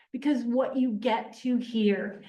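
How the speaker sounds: normal talking voice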